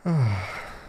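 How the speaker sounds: long, falling sigh; slow release of breath; relaxing sigh. Shown as a demonstration. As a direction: let the breath go in an audible sigh. Releasing breath before meditation starts.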